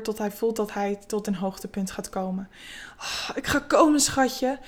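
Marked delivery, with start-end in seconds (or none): 3.0-4.6 higher, lightly groaned words; a touch of a groan, pitched up; moaning. A narrator's reading. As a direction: let a mild moan carry the words. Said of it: "zegt hij kreunend".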